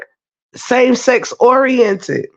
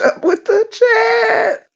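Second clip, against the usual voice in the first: much higher, crying-shout complaint; high overwhelmed cry; 13 semitones higher, slower than usual. Real, not acted. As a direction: wail it, swamped by messages. Overwhelmed by the chat.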